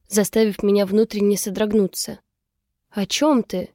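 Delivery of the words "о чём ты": ordinary delivery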